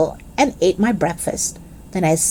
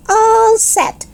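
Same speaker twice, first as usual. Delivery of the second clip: high, joyful holler; 12 semitones higher than usual. Acted. Girl cheers to her mother that she's set, high joyful holler. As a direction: cheer it out with joy. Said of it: "I hollered, real joyful".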